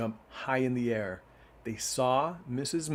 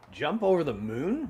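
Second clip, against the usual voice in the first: mocking repeat; 5 semitones higher, 3 dB louder than usual. A narrator's reading. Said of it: "repeated the hen".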